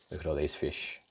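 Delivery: soft wonder at the page; hushed aside. Real, not acted. Showing the child the deep-sea fish, hushed. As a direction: whisper it in awe.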